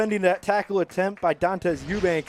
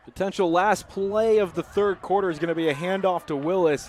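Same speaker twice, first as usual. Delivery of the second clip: normal talking voice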